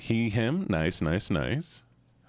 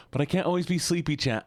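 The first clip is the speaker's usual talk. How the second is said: energetic voice; 8 semitones higher than usual. Shown as a demonstration. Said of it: "I know you love sleepy voice".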